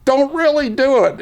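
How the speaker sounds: high, pressing warning; urgent call. Retold or imitated. Chief calls to stop the live drill, urgent and high. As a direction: call out not to do it for real. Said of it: "then he goes".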